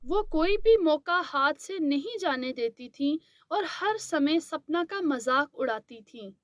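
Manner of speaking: ordinary delivery, nothing marked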